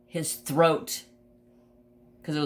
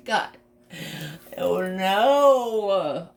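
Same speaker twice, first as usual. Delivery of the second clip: sudden rise on oh no, louder; dismayed groan; 5 semitones higher, 4 dB louder, much slower than usual. Retold or imitated. Hearing the litter is gone, a dismayed oh no. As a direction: let it drop like bad news landing. Learns no puppies are left.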